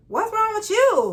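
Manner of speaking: high, loud, drawn-out mock demand; teasing concern. Acts out teasing the mopey friend.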